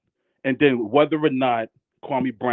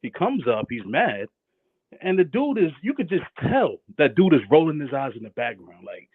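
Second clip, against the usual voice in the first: ordinary delivery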